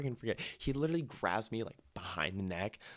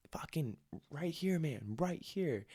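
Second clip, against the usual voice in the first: slow, high insistent call, lightly imitating the buddy; urgent buddy; 7 semitones higher, slower than usual. Buddy shoving the trash can forward, slow insistent call. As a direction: point at the bin and insist, slowly.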